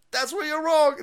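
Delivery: high mocking retort, falling at the end; mock retort again. Answering the critics, high mocking retort again. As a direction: squeal it back, then drop the last word. Second take.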